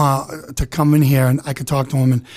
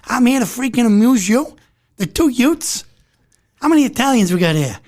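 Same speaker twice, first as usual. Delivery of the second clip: high-pitched wiseguy impression; needling comic gangster voice, pitched up; Joe Pesci; 7 semitones higher than usual. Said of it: "This is Joe Pesci".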